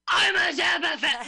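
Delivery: mock proud fan. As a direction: announce it like an eager superfan. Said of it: "definitely like".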